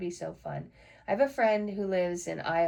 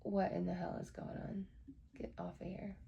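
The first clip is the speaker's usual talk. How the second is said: muttered to herself; 9 dB quieter than usual. Real, not acted. Muttering at a broken computer.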